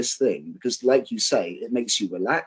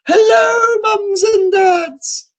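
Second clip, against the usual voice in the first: high, loud, long-drawn dame's cry; panto dame greeting; 8 dB louder, much slower than usual. Retold or imitated. Pantomime dame hollering a hello to the parents. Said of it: "the drag queen comes out, the dame".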